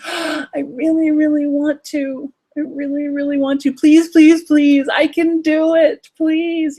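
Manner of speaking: put-on high, slow, tearful kid plea; desperate begging child. Inner nine-year-old begging the parent, high tearful plea. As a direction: beg like a child about to cry. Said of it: "your inner nine-year-old is saying".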